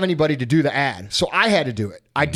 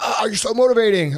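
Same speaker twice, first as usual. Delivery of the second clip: higher-pitched fawning imitation; mock gushing; 5 semitones higher than usual. Host mimics people calling him motivating, mock gushing. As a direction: gush with exaggerated admiration.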